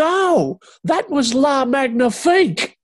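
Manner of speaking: high, loud, slow commentator cry; top of voice. Retold or imitated. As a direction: belt it at the top of your voice. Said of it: "go right to the top of his voice".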